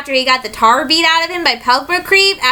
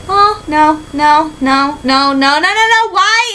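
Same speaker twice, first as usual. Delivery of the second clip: dismayed protest; slower than usual. Real, not acted. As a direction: plead against it in mock despair. Dismay at villain's return.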